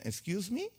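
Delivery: put-on high, light "Excuse me" like a child who forgot Hebrew; mock foreign kid. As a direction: play the child answering in a foreign tongue. Greek-speaking children answer in English.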